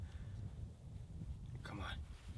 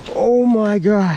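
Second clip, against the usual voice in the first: amazed; 6 semitones higher, 9 dB louder, slower than usual. Real, not acted. Stunned by a huge fish.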